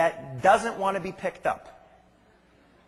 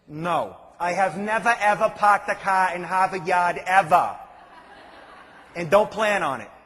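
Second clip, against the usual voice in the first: put-on Boston accent; 6 dB louder than usual. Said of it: "my usual, uh, response to them is".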